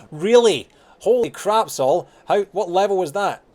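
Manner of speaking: loud, high-pitched disbelief; stunned exclamation, voice leaping up; real astonishment. Real, not acted. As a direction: blurt it out, amazed at the feat. Reacting to viewer's overleveled Reaper kill.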